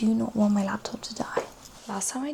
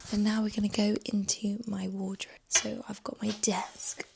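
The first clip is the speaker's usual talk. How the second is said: ordinary delivery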